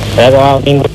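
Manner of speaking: usual speaking voice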